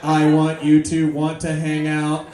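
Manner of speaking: slow, low robot monotone; mechanical robot voice. Acted. Needy robot to reluctant lover, slow mechanical monotone. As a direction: drone it slowly, word by word. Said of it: "that same mechanical voice".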